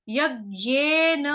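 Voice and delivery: यज्ञेन slowly, half ग pressed after य; slow yajnena. Shown as a demonstration. Teacher drills Sanskrit word यज्ञेन slowly for class. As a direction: draw out यज्ञेन with the half ग stressed. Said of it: "य के ऊपर आघात आएगा आधे ग का".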